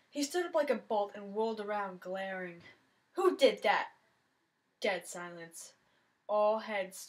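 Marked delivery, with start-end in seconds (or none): 3.1-3.9 loud demanding who did that; angry shout. Acted. Jock hit by a phone yelling at the room, angry shout. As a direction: bark it at the whole cafeteria.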